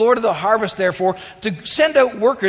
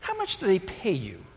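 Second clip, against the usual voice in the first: wry; 8 dB quieter than usual. Acted. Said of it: "he had this wry smile now".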